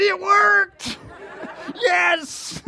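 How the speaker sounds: high, loud burst of triumph; whooping, high-pitched celebration; elated exclamation. Retold or imitated. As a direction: shout it out in mock triumph. Elated reaction retold to congregation.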